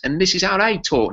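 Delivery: a quick mock Cockney impression; playful high Cockney accent. Shown as a demonstration. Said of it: "That's like a Cockney".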